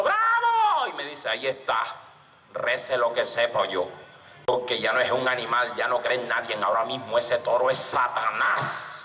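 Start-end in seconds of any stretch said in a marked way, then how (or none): 0.0-0.9 very high drawn-out cheer; crowd cheer. Acted. Crowd applauds Malibú's bullfight, high stretched cheer. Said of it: "Salió y todo el mundo". 1.3-8.9 low, grave pronouncement; ominous warning. Acted. Malibú warns the next bullfighter about the bull, low ominous voice.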